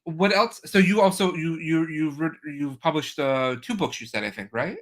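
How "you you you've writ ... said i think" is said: ordinary talk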